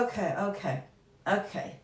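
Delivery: breathless, quiet after laughter; winded from laughing, barely voiced; catching breath after laughing. Real, not acted. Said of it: "Catching my breath".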